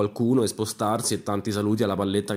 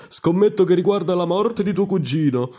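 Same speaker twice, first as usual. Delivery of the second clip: marshal's high, loud challenge; loud, heightened gambler's wager; old marshal betting; 6 semitones higher, 5 dB louder than usual. Acted. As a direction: push pitch and volume up to raise the stakes. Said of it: "rilanciò il maresciallo che aggiunse".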